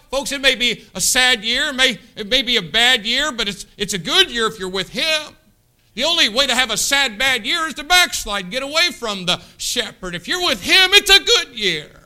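rising to a loud, high shout; loud preaching climax that keeps climbing in pitch; preaching at full force. Real, not acted. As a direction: build it up until you are shouting.

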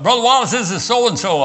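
high, hearty imitation of a caller; acted phone greeting. Old host phoning the preacher, acted hearty greeting. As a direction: pitch it up brightly as a caller.

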